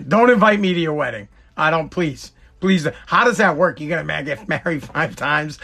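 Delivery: ordinary talk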